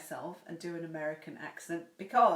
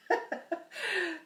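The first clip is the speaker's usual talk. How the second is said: laugh; 12 semitones higher than usual. Real, not acted. Laughing at her own joke.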